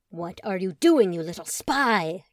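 loud, slow hammed-up villain; mock villain. Colin playfully scaring little brother Truman, a loud slow mock villain. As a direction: play the pantomime villain for the kid.

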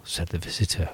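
plain reading voice

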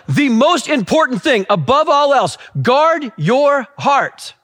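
high, slow, weighty declaration; emphatic preacher quoting Solomon; proclaimed scripture. Acted. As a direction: press each word home, pitched high. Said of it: "Solomon's like".

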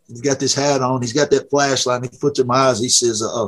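ordinary delivery

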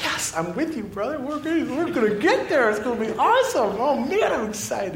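put-on playfully hyped-up reply to a kid; mock-giddy cheer to a little boy on the tram; excited. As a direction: play along, all eager and giddy. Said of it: "And I'm like".

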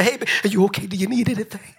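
mock fussing mama; doting mother voice. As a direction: fuss over her child. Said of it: "mama be in the".

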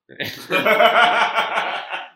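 loud, high, sustained laughter; big belly laugh. Real, not acted. Teller recalling getting back online anyway, loud laughing. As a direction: crack up helplessly.

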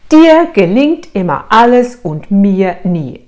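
slow, loud imitation of a husband's reproach; reproachful husband. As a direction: draw out each accusing word, hammed up. Husband's reproach to wife, quoted. (gespielt vorwurfsvoll, langsam und laut)

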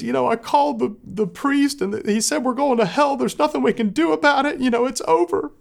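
high, imitated tearful wail; retold wife sobbing out the bad news; crying to us. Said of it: "she's crying to us".

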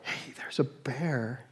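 soft, slow, hesitant warning; meek little warning nobody will hear; timid mention. Pretend. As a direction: mention the bear almost under your breath. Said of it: "are you gonna go".

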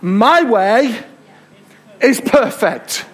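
high, loud, slow proclamation of God's word; full-force preaching. Acted. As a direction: preach it out as God's own voice, slowly.

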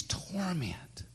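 single word in an unvoiced whisper. Real, not acted. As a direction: whisper torment to make it land.

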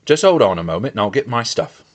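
mildly eager Sam; lightly acted eagerness; eager cry. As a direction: rush it out, keen to go. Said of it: "Sam cried eagerly".

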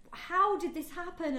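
honestly baffled, drawing it out; innocent confusion. Retold or imitated. Imitating Ross caught out by his feelings, innocent confusion. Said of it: "honesty and innocence and confusion".

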